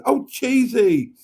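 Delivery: high, loud, slow call of the name; thrilled to see a friend arrive; delighted greeting. Real, not acted. Greeting a regular viewer in chat, delighted.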